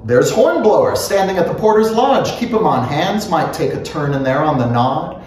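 ordinary delivery

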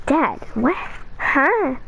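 ordinary talk

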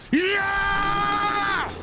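long high-pitched shouted cheer; real yell of triumph; victory scream. Real, not acted. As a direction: scream in triumph, holding the word out. Screams at beating the enemy.

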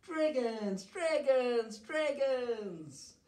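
high, happy crowd cheer; high-pitched acted cheering; storybook cheer. A narrator's reading. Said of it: "the people cheered".

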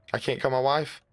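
ordinary talk, nothing marked

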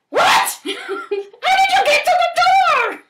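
high, loud laughing question; laughing surprise. Real, not acted. Child laughing at the whale at the door, high and loud.

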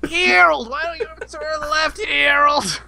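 high-pitched hammed-up nagging. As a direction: nag your spouse as an elderly New Yorker.

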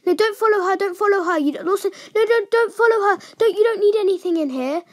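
high-pitched, hurried warning; urgent warning. Real, not acted. Player warning teammate off following, urgent and high. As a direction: urgently stop a teammate in their tracks.

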